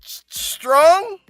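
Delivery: ordinary talk, nothing marked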